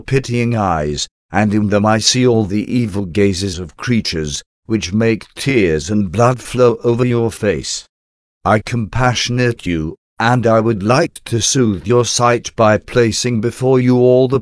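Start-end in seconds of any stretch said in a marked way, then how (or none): none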